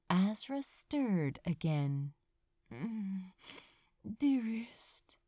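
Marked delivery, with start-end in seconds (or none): 2.7-5.2 soft drowsy murmur, half whispered; barely awake, murmur it softly; sleepy murmur. Acted. Azra half asleep murmuring to Crow, drowsy whisper. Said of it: "he murmured sleepily".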